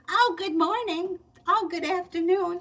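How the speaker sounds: high, loud, sing-out cheery greeting; mock an annoyingly sweet colleague's drawn-out hello; overly nice coworker. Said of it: "she was always very nice. And that was annoying".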